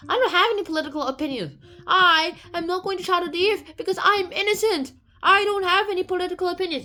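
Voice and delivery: high, loud, hurried mock indignation; indignant protest. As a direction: protest innocence emphatically. Dantes protests his sentence.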